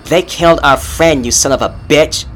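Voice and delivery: high, loud furious snarl; Kara lunges screaming at the monster. A narrator's reading. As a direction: hurl it at him in rage. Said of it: "Kara snarled".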